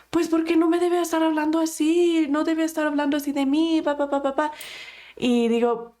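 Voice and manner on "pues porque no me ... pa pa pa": higher, fast mock complaint; indignant client. Client complaining to counselor about gossip, mock indignant. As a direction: imitate an offended client rattling off into pa-pa-pa.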